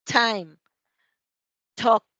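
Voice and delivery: "time", "talk" with a puff of air on the t; loud model words "time", "talk", t blown out; aspirated initial T. Shown as a demonstration. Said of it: "Dejamos salir aire".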